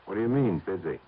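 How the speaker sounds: tense, muted phone voice; hushed on the phone. Acted. Hushed phone call at night.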